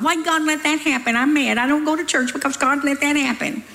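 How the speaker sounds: a hint of a petulant blamer; whiny complainer. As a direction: mimic a sulking grumbler. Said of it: "People are always blaming God".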